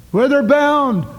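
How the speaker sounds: high, loud call across the sea; shouted hail. Acted. Preacher hails again, much stronger.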